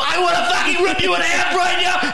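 very high imitation of furious shouting; hammed-up screaming threat; so mad. Comic imitates his enraged writing partner threatening him, high mad shout. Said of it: "you were so mad".

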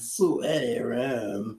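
slow, low, soft sultry echo. Pretend. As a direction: drag it out seductively. Echoing sultry voice.

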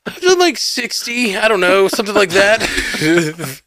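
squeaky high, sheepish boast; sheepish brag. Retold or imitated. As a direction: admit it in a squeaky voice. Retells bragging about escape rooms.

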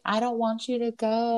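retold child's tearful plea; imitated crying child. Little girl begging a family friend to stay, lightly imitated. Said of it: "then they started crying".

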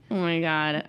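ordinary talk

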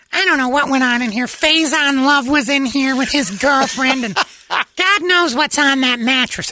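put-on high, slow, disgusted girlfriend voice; grossed-out girlfriend. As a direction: squeal it like she's grossed out. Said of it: "it grosses her out".

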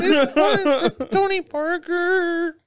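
man doing a woman's voice. Retold or imitated. Continues old lady voice.

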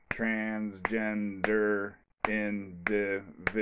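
very slow, syllable by syllable, soft; "Transgender individual" broken into eight slow, spaced syllables; clapped syllables. Shown as a demonstration. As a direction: count the beats of "Transgender individual" aloud. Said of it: "let's get back to the clapping".

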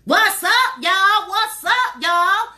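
high, loud country greeting; sing-out country hostess voice. Shown as a demonstration. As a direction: holler it country, stretching the hello. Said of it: "That's my country voice".

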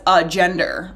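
normal talking voice

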